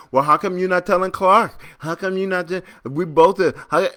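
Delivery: high, indignant kid imitation; seven-year-old protesting. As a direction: mimic a child objecting to being told off. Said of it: "My seven-year-old".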